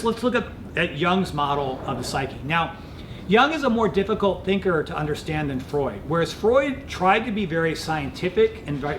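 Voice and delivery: ordinary talk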